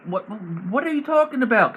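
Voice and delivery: confused enemy. Acted.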